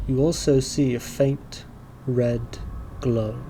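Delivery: slow game master narration, long pauses; scene setting. Acted. Game master describing an evocatory call and gloom, slow scene setting. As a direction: reveal it piece by piece.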